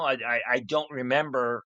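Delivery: ordinary talk, nothing marked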